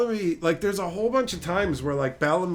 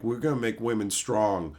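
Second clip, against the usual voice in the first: lower, dopey voice of clueless writers; a lightly lowered dopey voice; mock dumb writers; 7 semitones lower than usual. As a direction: play the writers as dim. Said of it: "they're like".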